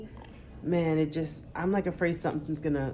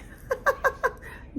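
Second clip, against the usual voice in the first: breathy laugh. Real, not acted. Laughs at the whisper.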